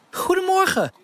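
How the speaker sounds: put-on girl cheerfully greeting the bakers. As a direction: greet them joyfully. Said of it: "zei Elise vrolijk".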